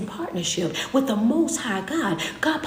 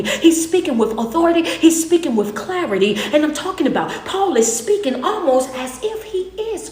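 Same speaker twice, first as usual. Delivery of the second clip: full-force preaching; 3 semitones higher, 6 dB louder than usual. Acted. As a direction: hammer the question home.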